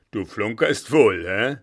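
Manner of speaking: blustering, incredulous challenge; loud disbelief. Acted. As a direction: accuse him of fibbing, loudly. Blücher disbelieves the officer's claim. (laut und ungläubig gepoltert)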